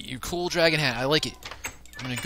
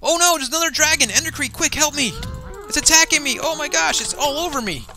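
high, raised, urgent cry for help; panicked plea; 12 semitones higher, 7 dB louder than usual. Pretend. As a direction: call for rescue mid-attack. Second dragon attacks, cries for help.

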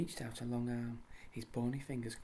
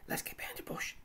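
quick urgent whisper; faster than usual. Acted. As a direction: hiss it to the others, keep hidden. Hiding from approaching footsteps.

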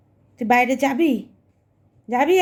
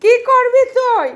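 exasperated coaxing; 10 semitones higher, 7 dB louder than usual. Real, not acted. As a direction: stretch it out, pleading with the pet. Owner coaxing restless pet.

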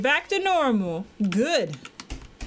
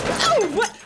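startled; 12 semitones higher, 4 dB louder than usual. Real, not acted. Startled by sudden noise.

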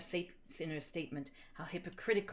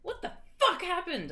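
high, loud hammed-up shock; shocked demand; 11 semitones higher, 5 dB louder than usual. A narrator's reading. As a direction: blurt it out in mock horror. Acted shocked question in fiction.

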